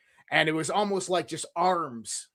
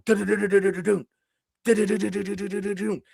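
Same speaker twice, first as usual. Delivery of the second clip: quick higher du-du-du rattle; rattling padlock sound; 4 semitones higher, faster than usual. Retold or imitated. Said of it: "the padlock is going".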